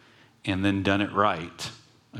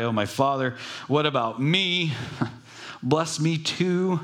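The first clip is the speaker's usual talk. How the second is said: bitter cry; 5 semitones higher than usual. Acted. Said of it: "a loud, bitter cry".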